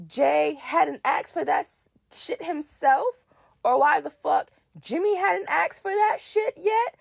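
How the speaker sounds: ordinary talk, nothing marked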